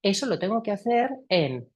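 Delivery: ordinary talk, nothing marked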